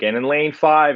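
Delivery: introducing a lane at a competition, announcer voice; announcer call. Retold or imitated. Announcing lanes at a meet.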